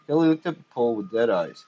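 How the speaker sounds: plain reading voice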